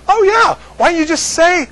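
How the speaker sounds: loud, high acted exasperation; sudden realisation. Acted sudden understanding, mild exasperation.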